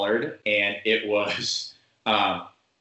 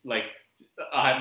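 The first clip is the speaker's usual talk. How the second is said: normal talking voice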